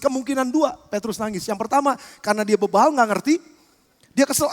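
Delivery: ordinary talk, nothing marked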